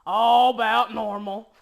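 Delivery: loud, slow country dad impression; daddy's deep country voice. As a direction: boom it slowly like a deep-voiced country father. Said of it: "talks that country and that deep".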